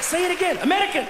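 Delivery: high, loud, delighted demand; excited. Retold or imitated. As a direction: beg to hear it again, thrilled. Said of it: "I was like".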